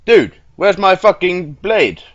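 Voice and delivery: loud, high, stretched-out demand; exasperated. Real, not acted. Player demanding his missing blade, exasperated. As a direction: cry out in indignant disbelief.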